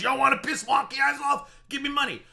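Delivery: high-pitched imitation of a begging streamer; mocking quote. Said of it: "the first thing he said was".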